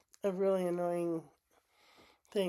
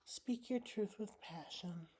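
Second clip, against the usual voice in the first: much softer, almost to herself; quiet echo; 12 dB quieter than usual. Shown as a demonstration. Repeating the card's words to herself, much softer. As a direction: let it sink in, murmured back. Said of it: "And this says".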